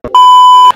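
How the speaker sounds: sharp loud shocked gasp. Pretend. Speaker gasping in shock to grab attention. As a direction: gasp as if stunned. Mock shocked reaction opening.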